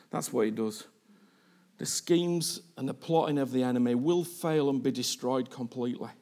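quiet reflective preaching. Acted.

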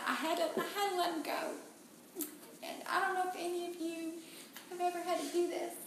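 real grief breaking through. Real, not acted. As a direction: voice cracking high and soft with tears. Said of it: "crying while recalling son".

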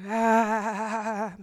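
low and soft, calling gently. Acted. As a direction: murmur it like a summons. Voicing God calling softly.

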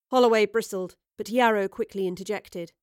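ordinary delivery, nothing marked

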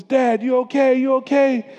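a lightly voiced anxious son calling out; worried son. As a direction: voice the son checking on his dad. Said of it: "such a dear son, he says".

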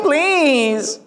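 put-on high, stretched-out childish whine; hammed-up kiddie complaint; whiny kid. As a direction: protest like a sulking child, dragging the words. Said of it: "You know how kids do".

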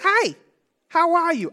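put-on high-pitched little-kid greeting; imitating toddler son. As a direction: chirp it like a small child to his reflection. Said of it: "and he just goes".

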